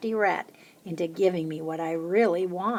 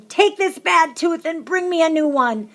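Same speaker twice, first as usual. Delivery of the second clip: high, loud shout; 9 semitones higher, 7 dB louder than usual. A narrator's reading. Child throwing a tooth over the roof, shouting. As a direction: fling the words at the roof.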